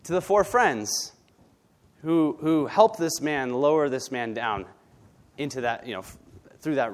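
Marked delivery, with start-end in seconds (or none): none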